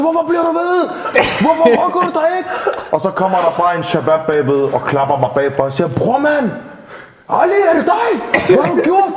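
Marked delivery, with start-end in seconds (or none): 0.0-2.5 high, strained mock shout; retold yelling. Driver reenacting still yelling at the woman he hit, high mock shout. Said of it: "så råber jeg stadig til". 5.9-9.1 imitated alarmed friend. Storyteller voicing a friend finding him at the accident, high alarmed voice.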